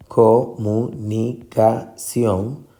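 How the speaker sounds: comunicación in separate beats, co, mu, ni, ca, ción; syllable by syllable. Shown as a demonstration. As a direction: slowly count out each syllable of comunicación. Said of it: "La sílaba tónica en comunicación". (comunicación pausada, separada en sílabas)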